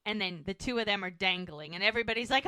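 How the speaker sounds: usual speaking voice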